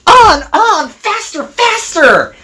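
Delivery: high-pitched egging-on; gleeful urging. A narrator's reading. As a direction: goad the engine in a high voice. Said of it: "laughed the troublesome trucks".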